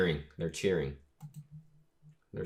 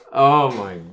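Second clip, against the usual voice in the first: loud, raised exclamation falling away; amazed; 5 semitones higher, 13 dB louder than usual. Real, not acted. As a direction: exclaim it in real awe. Amazed reaction to the reveal.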